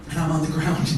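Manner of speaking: ordinary talk, nothing marked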